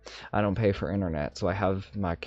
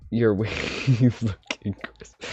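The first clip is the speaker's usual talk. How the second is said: louder, slowed by laughter; breaking up laughing; 2 semitones higher, 6 dB louder, slower than usual. Real, not acted. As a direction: try to say it through a laugh. Laughs while repeating the phrase.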